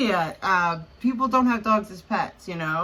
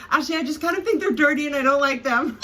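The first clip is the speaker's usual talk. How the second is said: higher, fast imitation of a friend owning up; imitated blunt confession; 6 semitones higher, 4 dB louder, faster than usual. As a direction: blurt it out quickly as the friend, higher and amused.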